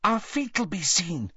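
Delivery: high, worried voice; anxious, slightly hushed worry. A narrator's reading. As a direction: fret about being spotted, pitched high. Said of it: "said Hermione anxiously".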